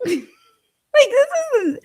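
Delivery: high-pitched words breaking into laughter; laughing. Real, not acted. Mocking his camera on himself, laughing. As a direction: crack up while trying to talk. Cracking up at his self-filming.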